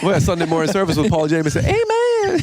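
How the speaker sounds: high mock church "amen"; mock preacher. Host teases a friend, mock preacher voice. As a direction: ham it up like a Sunday preacher. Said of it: "Chloe's getting an amen back there".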